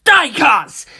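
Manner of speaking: high, loud hateful yell. A narrator's reading. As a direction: yell it with all his hate. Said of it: "Narancia yelled".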